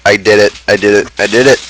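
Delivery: ordinary talk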